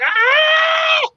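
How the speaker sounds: put-on very high girlish shriek; piercing coaster scream; mock scream. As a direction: imitate a rider screaming. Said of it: "That's me screaming".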